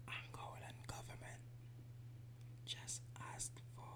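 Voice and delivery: hushed whisper about politics. Real, not acted. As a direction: whisper it so nobody hears. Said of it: "Why are you whispering?".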